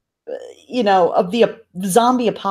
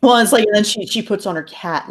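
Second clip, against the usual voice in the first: usual speaking voice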